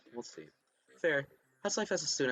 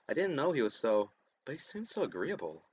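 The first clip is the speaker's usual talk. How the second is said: ordinary talk, nothing marked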